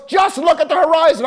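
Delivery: loud, high repeated orders; shouted commands. Retold or imitated. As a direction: hammer the orders at a panicking student. Instructor shouting back.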